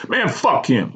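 high-pitched irritated retort; snapping. A narrator's reading. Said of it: "I snapped".

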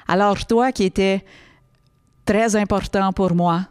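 "très" and "pour" with exaggerated tongue-rolled r. Shown as a demonstration. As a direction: trill every r, as in "Alors" and "important". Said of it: "en roulant des airs".

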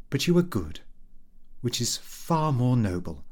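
a hint of a fond wife; lightly voiced loving wife. Wife answers husband lovingly.

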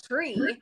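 "tree" slowly, with a hard t; uncle's THREE. Retold or imitated. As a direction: insist on "tree" with t, not th. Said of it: "My uncle said it's tree".

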